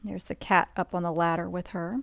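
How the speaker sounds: plain reading voice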